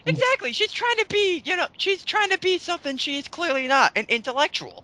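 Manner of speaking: squealing exactly, exactly; excited agreement. Real, not acted. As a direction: jump in thrilled to agree.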